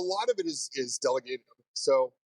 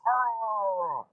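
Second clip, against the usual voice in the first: angry mumbled roar; 9 semitones higher than usual. Retold or imitated. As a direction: imitate a mad, garbled yell, drawn out. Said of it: "I hear him mumbling, and he's just getting really mad".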